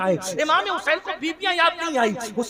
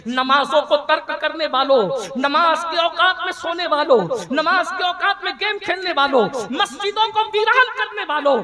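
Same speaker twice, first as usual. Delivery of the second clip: building rebuke; 3 semitones higher, 4 dB louder than usual. Real, not acted. Preacher calling out those who abandon prayer, building rebuke.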